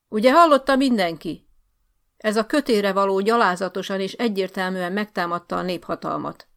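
outraged accusation. Acted. Officer accusing Bálint before everyone, outraged loud. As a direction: denounce him to the room.

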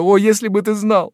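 high loud cry, stressing о; first take, peaking on о; tearful exclamation. Acted. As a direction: wail "о, если бы ты знал" high and loud. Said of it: "заливаясь слезами, воскликнула".

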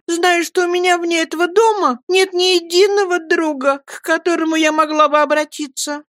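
high, hurt, tearful pleading; tearful mother. A narrator's reading. Mother reproaches Peggotty, high and tearful. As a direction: protest your loneliness.

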